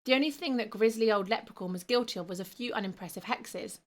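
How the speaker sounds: ordinary delivery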